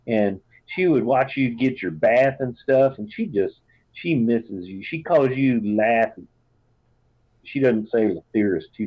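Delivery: put-on a fond Southern mom writing to her child; Southern mother. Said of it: "really Southern".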